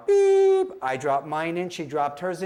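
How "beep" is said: high, loud voiced tone; imitated audiometer beep. Playing the audiometer for the girl, high loud beep. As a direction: pipe a piercing beep. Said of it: "with the audiometer now".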